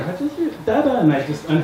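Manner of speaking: soft, high little Dada; imitating daughter. Father recalls his daughter greeting him, a soft high coo.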